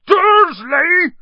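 huge, high roar of the name; explosive, drawn-out boom; booming shout. A narrator's reading. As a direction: bellow it in outrage. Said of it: "he boomed".

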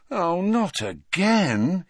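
drawn-out, high groan of exasperation; long exasperated moan. A narrator's reading. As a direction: groan it slowly, fed up. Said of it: "he groaned".

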